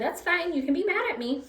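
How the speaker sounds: lightly replayed reassurance; retold reassurance to dog. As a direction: tell the dog it's fine, lifted. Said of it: "There I said".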